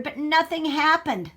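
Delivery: ordinary delivery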